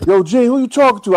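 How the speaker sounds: loud high shout from upstairs; retold yell. Puff yelling down to Gene from upstairs. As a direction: call down the stairs, loud and high.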